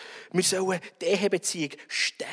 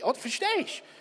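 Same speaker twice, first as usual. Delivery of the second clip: confused; 10 semitones higher than usual. Acted. Acted confused husband.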